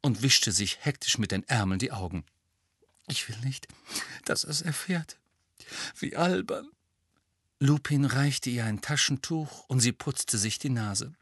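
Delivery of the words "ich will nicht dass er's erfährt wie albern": tearful whisper. A narrator's reading. Crying mother, half whispering.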